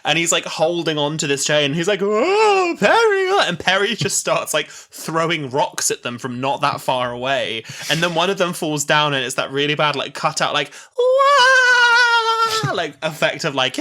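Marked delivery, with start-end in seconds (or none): 2.0-3.5 high, loud groaning call; mock cry for help. Acting Doctor hanging on chain. 11.0-12.7 long, loud, high wail; mock falling scream. Imitating a man falling.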